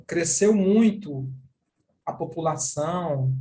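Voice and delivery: slow, stretched muito. Shown as a demonstration. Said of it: "então eu vou fazer na minha boca".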